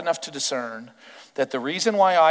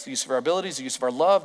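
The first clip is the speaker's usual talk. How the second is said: ordinary delivery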